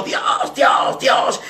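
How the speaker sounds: high, loud repeated howl; crazed screaming. Retold or imitated. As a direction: scream it like a madman, imitated. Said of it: "gritaba alarido como un esquizofrénico".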